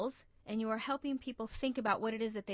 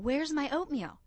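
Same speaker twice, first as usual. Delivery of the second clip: mild mock yell; 5 semitones higher, 4 dB louder than usual. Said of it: "she's yelling across the room".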